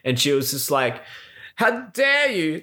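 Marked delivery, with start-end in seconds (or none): 1.6-2.6 high, slow, wooden outrage; bad acting. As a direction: ham it up like a stiff B-movie actor. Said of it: "like, the worst acting ever".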